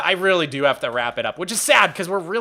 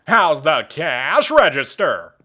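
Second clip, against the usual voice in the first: slow, drawn-out cartoon snob impression; Squilliam; 4 dB louder, much slower than usual. Shown as a demonstration. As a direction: stretch the words like a cartoon rival needling someone. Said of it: "I wish Squilliam had more lines".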